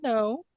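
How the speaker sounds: a small rising "No."; questioning no. Retold or imitated. The bully answers the girl with a timid, rising no. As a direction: say "No." unsure, going up at the end. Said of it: "With a question mark at the end".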